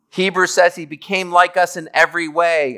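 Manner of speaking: ordinary talk, nothing marked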